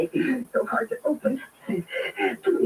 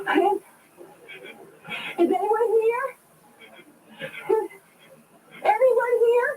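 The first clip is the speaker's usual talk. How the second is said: calling out; 9 semitones higher than usual. Acted. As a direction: call out into a dark, empty gas station. Calling into closed station.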